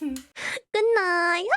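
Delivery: high, drawn-out cutesy goodnight; imitating Lola. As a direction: echo a little one's goodnight in a tiny high voice. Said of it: "You sound just like Lola".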